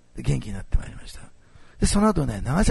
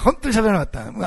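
laughing repeat; 8 semitones higher, 5 dB louder than usual. Real, not acted. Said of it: "それぐらい本当に喋らなかったの".

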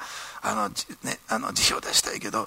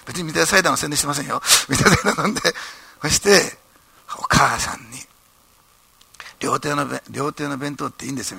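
ordinary talk, nothing marked

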